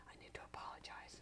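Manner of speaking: fast, breathless whisper to herself; whispered thought. Retold or imitated. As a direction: whisper it like a private realization. Thinking to herself after yelling.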